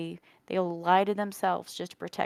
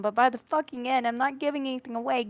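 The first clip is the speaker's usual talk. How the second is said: normal talking voice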